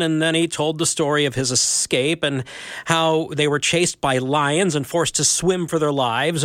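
plain reading voice

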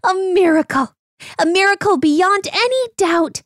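high, thrilled donkey voice; donkey crying out. Acted. Donkey amazed at his new strength, crying out high. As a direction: cry it out in wonder. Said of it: "the donkey cried out".

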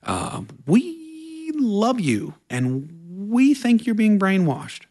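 high, slow, sing-song mock concern; a hint of fretting family pleading. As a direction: lightly imitate worried relatives. Family urging him to quit church.

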